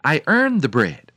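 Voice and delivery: emphatic, mildly hammed-up protest; indignant protest. A narrator's reading. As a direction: stand up for yourself as the hen. Hen protests to the farmer.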